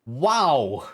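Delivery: loud, slow, stunned outburst; holy heck, dragged out loud; shocked exclamation. Real, not acted. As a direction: blurt it out in disbelief. Shocked reaction to the story.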